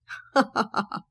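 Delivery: scornful short laugh; mocking laugh. Hatem laughing scornfully at Sara. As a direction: laugh her off with contempt. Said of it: "قعد يضحك عليها بسخرية".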